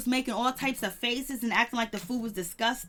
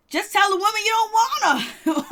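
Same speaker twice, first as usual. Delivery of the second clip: high-pitched exasperated exclamation; exasperated outburst; 8 semitones higher, 4 dB louder than usual. Retold or imitated. Viewer telling Mark off from afar, exasperated outburst. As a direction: blurt it out high, fed up with him.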